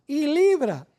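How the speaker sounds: a preacher's emphatic "libra"; stress on libra. Shown as a demonstration. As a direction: punch "libra" as the key word. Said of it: "otra vez la palabra clave".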